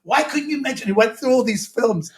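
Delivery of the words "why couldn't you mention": high, aggrieved pleading; Robin Williams, hurt ego. Retold or imitated. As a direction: act out a wounded star's indignant protest. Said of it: "It really hurt his ego".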